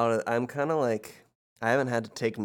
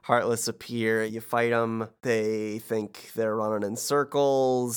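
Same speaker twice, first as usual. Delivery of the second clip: normal talking voice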